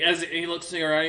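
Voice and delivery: usual speaking voice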